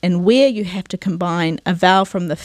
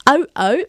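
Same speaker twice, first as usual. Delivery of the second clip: "Au, au" sharp and high like a barking dog; playful bark demo; 6 semitones higher than usual. Shown as a demonstration. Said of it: "the dog barking".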